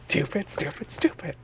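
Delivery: breathy self-scolding whisper. A narrator's reading. Narrator whispering John's self-scolding thought. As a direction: hiss it under your breath at yourself.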